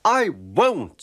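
stubborn Daisy. Acted. Daisy refuses the driver.

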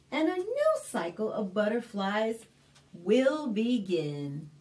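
put-on clear, lively read-aloud for little kids; story narration. Librarian reading a picture book to kids, lively storytelling.

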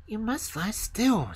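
put-on slow, high, firm girl's order; scolding robber girl. A narrator's reading. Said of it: "said the little robber girl".

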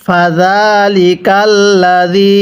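drawn-out Arabic recitation, slow; slow Quran recitation. Shown as a demonstration. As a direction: recite slowly for students to repeat. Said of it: "আরেকবার আমার সাথে পড়ুন". (ধীরে কুরআন তিলাওয়াত)

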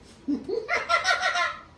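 high-pitched scornful cackle; mocking laugh. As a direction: cackle at the child's backtalk. Mother laughs at the backtalk.

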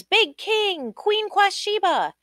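high ringing call of names; crowd calling out. Acted. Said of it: "Everybody called out their names".

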